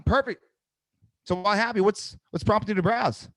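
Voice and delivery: upbeat high-pitched sales pitch; bright lift on every question, high energy. Shown as a demonstration. Coach showing agents how to answer just browsing, high energy. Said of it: "I'm just having higher energy".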